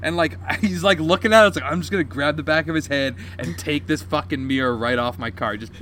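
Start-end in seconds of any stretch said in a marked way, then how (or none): none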